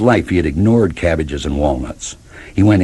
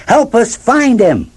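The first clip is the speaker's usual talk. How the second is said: urging; 14 semitones higher, slower than usual. Acted. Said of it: "the dogs urged".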